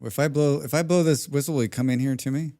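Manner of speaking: ordinary talk, nothing marked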